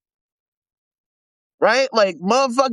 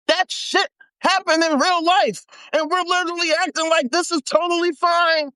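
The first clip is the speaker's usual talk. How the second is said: ordinary talk, nothing marked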